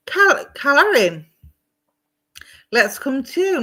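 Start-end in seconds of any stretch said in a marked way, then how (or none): 0.1-1.2 stumbling "Cal-, Calorin", higher and louder, L before R; retry the slip, breaking off at "Cal-" before "Calorin"; name slip. Retold or imitated. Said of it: "I tripped over my words".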